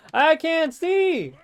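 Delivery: high, loud, drawn-out yell; frustrated yell. Real, not acted. Repeating it, escalating, during gameplay.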